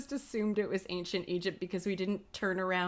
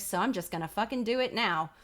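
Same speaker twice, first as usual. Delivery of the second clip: ordinary delivery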